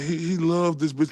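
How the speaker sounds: usual speaking voice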